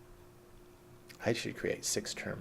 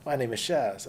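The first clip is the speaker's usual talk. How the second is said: high, loud "guess what" then his name; spring the surprise on the caller; a mock-bright gotcha greeting; cheeky reveal; 5 semitones higher, 7 dB louder than usual. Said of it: "I'm like".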